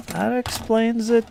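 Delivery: high, slow, drawn-out remark. Pretend. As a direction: stretch it out in a high put-on voice. Joke about two heads.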